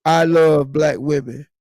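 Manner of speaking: low, slow reading of Reggie's words; quoting Reggie. Retold or imitated. Quoting a guest's comment to the audience, slow and low. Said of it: "Reggie said".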